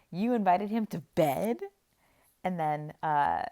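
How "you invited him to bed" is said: mock shock. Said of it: "Simon says".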